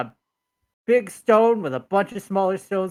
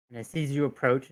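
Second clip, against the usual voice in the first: usual speaking voice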